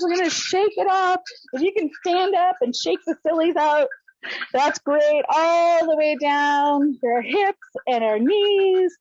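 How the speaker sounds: walk them through the body calmly; guided relaxation. Acted. Leader guides the group through a body shake-off, calm.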